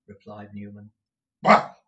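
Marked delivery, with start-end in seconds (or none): 1.4-1.7 loud scornful bah; impatient bah. Acted. Ralph scoffing at his clerk, loud impatient bah. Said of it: "cried Ralph impatiently".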